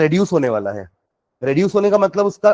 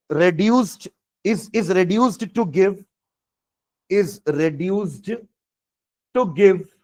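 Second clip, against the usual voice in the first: slow, drawn-out dictation voice; much slower than usual. Shown as a demonstration. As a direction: spell it out slowly for note-taking. Dictating notes.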